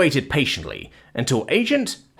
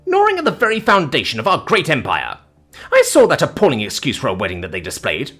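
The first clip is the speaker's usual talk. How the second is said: haughty dame on an indignant tirade; affronted matron's relentless lecture; scolding verbal onslaught; 3 semitones higher than usual. Acted. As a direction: barrel on, rising to high outrage. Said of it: "the verbal onslaught".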